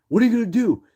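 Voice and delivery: very high, loud mock yell; taunting shout. Teens daring the man from hiding, shouted. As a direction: dare him, shouting.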